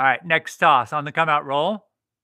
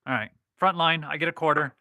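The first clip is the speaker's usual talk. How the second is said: usual speaking voice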